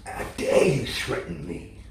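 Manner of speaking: low, hushed menacing threat; a quiet growl of warning, not a yell; understated indignant menace; mock righteous indignation. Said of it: "righteous indignation that says".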